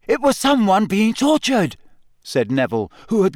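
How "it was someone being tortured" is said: high, tense, scared voice; a boy gone pale with fright; frightened. A narrator's reading.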